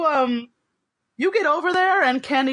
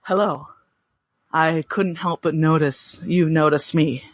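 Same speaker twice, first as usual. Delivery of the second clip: dusky voice; 6 semitones lower than usual. Acted. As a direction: purr it low and seductive. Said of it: "I would say dusky".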